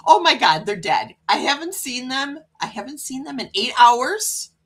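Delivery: mock panicked cat thoughts; lightly voiced anxious cat; worried cat. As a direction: play a cat fretting over its missing owners. Voicing the cats' panic.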